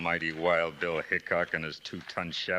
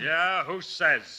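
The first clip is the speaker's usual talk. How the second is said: higher, louder, slow taunt; defiant sneer; 10 semitones higher, 4 dB louder, much slower than usual. Acted. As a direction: drag out the challenge. Villain defiant retort.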